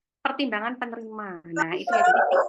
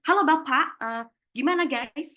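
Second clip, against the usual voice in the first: loud, higher mock-hip greeting; trendy youngster, too casual; 4 semitones higher, 5 dB louder than usual. Shown as a demonstration. Trendy candidate greeting an interviewer, cheekily casual. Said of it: "Kalau Anda itu anak kekinian".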